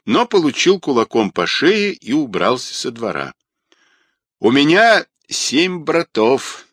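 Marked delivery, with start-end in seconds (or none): 4.4-6.7 slow, loud, high shout. Acted. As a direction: yell it as a threat. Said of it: "крикнул фотограф".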